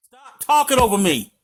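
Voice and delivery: high, commanding scold; domineering. Real, not acted. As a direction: shut the caller down firmly. Host scolds interrupting caller.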